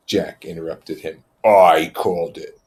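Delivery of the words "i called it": defiant. Acted. Jack asserting, loud.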